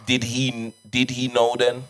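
usual speaking voice

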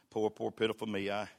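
normal talking voice

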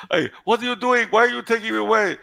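high-pitched mock protest; hammed-up high voice, quick alarmed questions; protesting old nun. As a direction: play an indignant nun being dragged off. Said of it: "the old Spanish nun".

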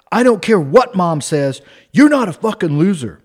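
loud, defiant mock self pep talk; retold yelling. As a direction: yell it at your own reflection, acted out. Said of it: "he's yelling and slapping himself".

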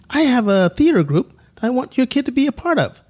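high, hammed-up pitch to parents; scammer's sales pitch. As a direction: play a smooth talker selling a theater group.

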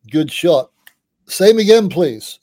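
put-on hearty drunk friend. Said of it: "And Daryl just goes".

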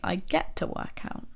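gentle, grateful hush; soft and glad. Shown as a demonstration. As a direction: say it like a small gift to yourself. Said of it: "The emotion behind these things is so different".

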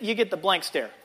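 ordinary talk

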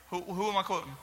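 ordinary delivery

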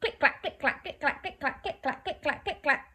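high, rhythmic click-clack; clattering skeleton bones. Shown as a demonstration. Skeletons forcing monsters to flee, high rattling click-clack. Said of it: "they're all clattering everywhere".

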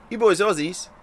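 a hint of a friendly barman; barman's question. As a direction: ask it like a curious bloke at the bar. Barman asks the two mates.

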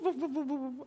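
high, strained whooping cries in a borrowed voice; Pastor Brad's whoop. Retold or imitated. To the congregation, imitating a fellow pastor's whoop, high-pitched. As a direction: yelp out a preacher's signature whoop. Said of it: "I can't do it like Pastor Brad".

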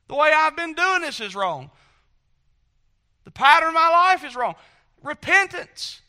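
loud, high, building sermon cry; preaching at full force. Acted. Preacher crying out to the congregation about repentance. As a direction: preach it out with rising fervor.

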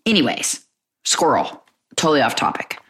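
usual speaking voice

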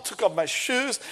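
ordinary delivery

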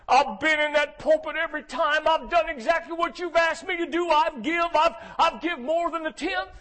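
high, loud preaching as Elijah complains; Elijah pouring it out. Acted. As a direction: let it all out, rising and shouting.

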